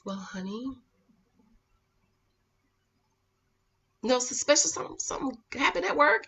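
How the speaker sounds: hushed soothing explanation; calm husband. Retold or imitated. Husband's calm reply voiced.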